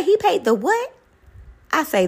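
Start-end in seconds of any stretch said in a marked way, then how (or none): 0.0-0.9 high, incredulous what; hammed-up disbelief; mock disbelief. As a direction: act out her shock. Mother-in-law shocked at news.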